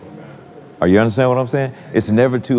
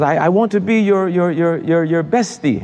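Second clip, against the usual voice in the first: lightly voiced French preacher, pitched up; mock high preacher voice; 7 semitones higher than usual. Preacher mimics a driver in Paris, pitched-up mock voice. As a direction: imitate the driver asking to be your bestie.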